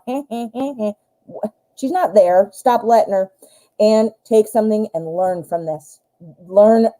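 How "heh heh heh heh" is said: soft mocking heh heh heh; mocking snicker. The invisible ex poking him, soft mock snicker. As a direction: needle him with a quiet snicker.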